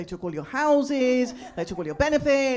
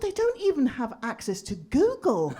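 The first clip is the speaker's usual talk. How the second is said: normal talking voice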